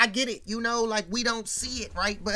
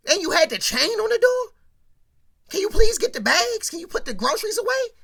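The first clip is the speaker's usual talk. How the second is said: mother scolding, pitch climbing higher toward the end; a fed-up mom nagging her son about the bags; tired exasperated mother; 5 semitones higher, 7 dB louder than usual. Retold or imitated. Mother scolding her son for not helping carry groceries, exasperated and rising higher. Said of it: "She like".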